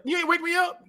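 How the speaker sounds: high-pitched mock grumble at a dog; put-on squawky complaint; nasty character voice. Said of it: "he had that nasty ass voice".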